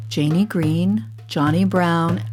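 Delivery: plain reading voice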